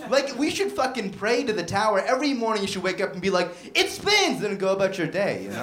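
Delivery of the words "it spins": high, exclaimed celebration; mock awed cry. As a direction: cry it out like a morning devotion. Acted morning cry to a tower.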